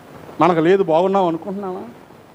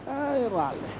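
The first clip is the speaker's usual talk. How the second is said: weary refusal; 5 semitones higher, 11 dB quieter, much slower than usual. Retold or imitated. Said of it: "హృదయం కేకలేస్తే ఎక్కడ కనబడుతుంది".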